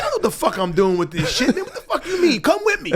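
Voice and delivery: high, frantic, half-laughing plea; panicked pleading. Retold or imitated. Panicked plea to the dealer, half laughing. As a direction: beg him to come, panicking.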